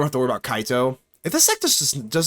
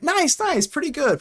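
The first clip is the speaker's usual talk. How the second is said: chirpy reply; 12 semitones higher than usual. Real, not acted. High bright reply.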